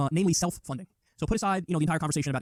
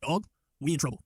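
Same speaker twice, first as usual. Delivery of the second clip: lower, casual voice put on for Kerry; lightly voiced slangy aside, a touch down in pitch; hint of a worried pal; mock worried buddy; 4 semitones lower than usual. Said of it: "He's like".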